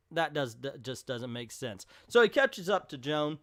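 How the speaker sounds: usual speaking voice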